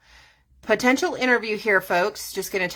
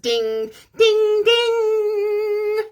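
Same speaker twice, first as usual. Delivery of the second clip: piercing mock bell, louder and far higher; aggressive bell ding; 7 dB louder, much slower than usual. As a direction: ring it out like a harsh chime. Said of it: "This one's a little bit more aggressive".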